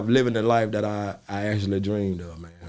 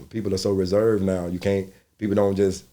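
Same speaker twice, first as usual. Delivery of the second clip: ordinary talk, nothing marked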